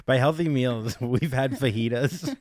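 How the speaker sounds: teasing through laughter, words stretched slow; laughing tease. Real, not acted. Teasing partner about their healthy cooking, laughing. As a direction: poke fun at the healthy meals, giggling.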